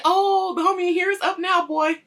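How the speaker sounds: loud, high mock excitement; hyped homie. Acts homie greeting sleeping Vince.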